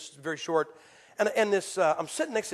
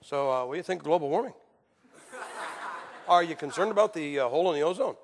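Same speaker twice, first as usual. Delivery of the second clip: serious adult talk; 4 semitones lower, faster than usual. Retold or imitated. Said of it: "pick up a baby and go".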